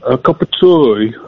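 put-on slow, careful try at a Brummie accent; attempted Brummie accent. Slow, trying Brummie accent. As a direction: caller tests his Brummie accent on the host. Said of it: "I can't get mine quite as good as yours".

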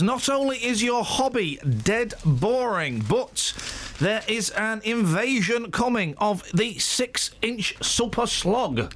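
put-on slow, drawn-out Manchester accent; milk the doom in Manchester accent. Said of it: "my Manchester voice".

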